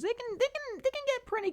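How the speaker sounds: very high squeezed chipmunk voice; cartoon chipmunk demo. Shown as a demonstration. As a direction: pitch it way up like a chipmunk. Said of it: "voice impressions".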